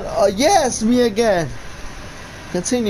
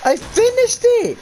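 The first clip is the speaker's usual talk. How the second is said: very high excited cry; elated shout. Real, not acted. Player finishing the map, elated shout. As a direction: exclaim it high in triumph.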